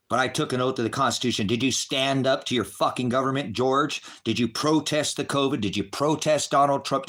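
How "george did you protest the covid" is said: usual speaking voice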